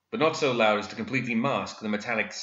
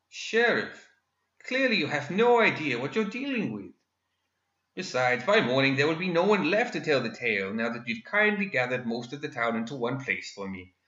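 much higher, smug gentleman villain; gloating villain; 5 semitones higher than usual. Acted. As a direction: taunt with polite menace. Villain taunts the sheriff.

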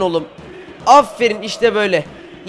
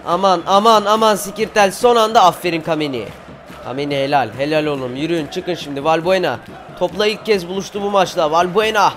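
normal talking voice

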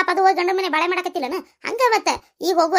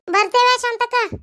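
high, slow farewell call; calling goodbye; 7 semitones higher, 4 dB louder, slower than usual. Acted. Departing guest to Shantakka, calling out goodbye. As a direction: call it out across a distance.

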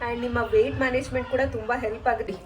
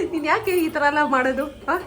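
higher-pitched playful challenge; teasing mock interrogation; 5 semitones higher than usual. Daughter teasing her mother about her fasting, mock scolding. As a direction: pretend to take your mother to task. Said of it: "ನಾನ್ ಸುಮ್ನೆ ನಮ್ಮ ಅಮ್ಮಂಗ್ ತಮಾಷೆ ಮಾಡ್ತಾ ಇದ್ದೆ".

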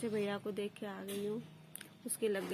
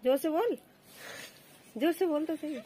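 louder urging; 7 semitones higher, 7 dB louder than usual. Real, not acted. Mother urging daughter to speak up.

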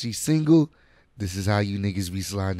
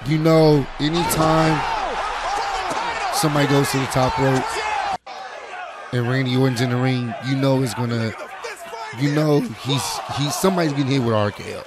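excited; 3 semitones higher, 4 dB louder than usual. Real, not acted. Hyped reacting to RKO clips.

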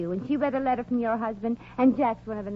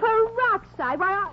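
drawn-out, much higher, louder indignant echo; outraged disbelief; 12 semitones higher, 4 dB louder, slower than usual. Acted. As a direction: repeat the insult back in slow, scandalized disbelief. Maisie insulted by rival's remark.

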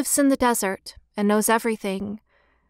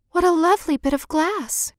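put-on high-pitched, wondering child; lifted, innocent delight; little girl's delighted cry; 7 semitones higher than usual. A narrator's reading. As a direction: exclaim like a small girl finding treasure. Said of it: "cried the little girl".

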